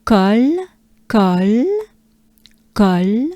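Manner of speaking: "col" with a wide open vowel. Shown as a demonstration. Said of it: "open A".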